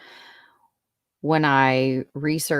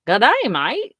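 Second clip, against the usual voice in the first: mock Australian accent; 6 semitones higher, 6 dB louder than usual. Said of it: "tried to do his accent back".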